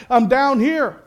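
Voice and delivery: high, acted shout back to a rescuer; calling up eagerly. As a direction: answer loudly from far below. Stranded Jack calls up to voice.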